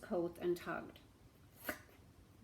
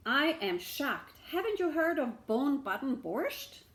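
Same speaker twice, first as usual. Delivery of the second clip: higher, louder feigned shock; mock shock; 5 semitones higher, 6 dB louder than usual. Beggar pretending shock at the shamus, mock amazed. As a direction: act astonished.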